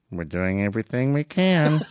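imitated first lady feeding a line; mock stage prompt from the wife; Nancy Reagan. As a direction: prompt him discreetly in a put-on voice. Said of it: "And Nancy's, like, one foot away".

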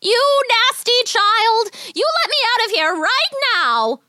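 high, slow, furious witch cry. A narrator's reading. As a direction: shriek it like a trapped witch. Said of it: "the witch cried from inside".

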